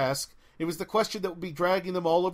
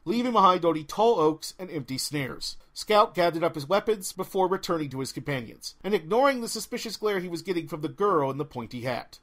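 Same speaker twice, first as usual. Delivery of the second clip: plain reading voice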